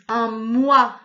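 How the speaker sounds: "moine" slowly as a loud moi, then ne; drawn-out "moine" with a separate final ne; split syllables. Shown as a demonstration. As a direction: cut "moine" in two, leaning on moi. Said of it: "c'est coupé".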